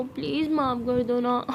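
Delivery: slow, drawn-out wheedling; playful pleading. Acted. Siya begging Tara to forgive her, coaxing. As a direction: beg cutely, stretching the words.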